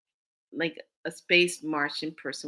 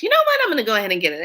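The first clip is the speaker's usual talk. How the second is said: friend's sudden loud, high announcement; high, loud opening that settles quickly; excited change of mind; 4 semitones higher, 7 dB louder, much faster than usual. Retold or imitated. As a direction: burst out with a retold decision.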